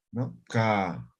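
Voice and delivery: normal talking voice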